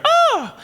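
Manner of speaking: high delighted shriek of recognition; a sudden joyful cry across the room; mother's high shriek of delight; excited shriek. Retold or imitated. Mother's shout across church.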